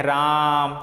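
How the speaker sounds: राम with a very long held आ; raaam, the vowel of राम prolonged; drawn-out vowel. Shown as a demonstration. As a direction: stretch राम out as if calling someone. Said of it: "लंबा खींचते हैं जहाँ पे".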